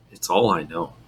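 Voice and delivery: low, softly quoted resignation; almost dejected. Retold or imitated. Quoting the Hobo's reply to Abby, almost dejected. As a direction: let it drop, mildly defeated. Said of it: "almost dejectedly, he says".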